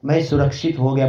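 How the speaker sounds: ordinary talk, nothing marked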